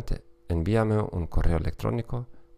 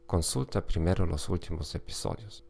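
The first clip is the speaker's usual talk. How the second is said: usual speaking voice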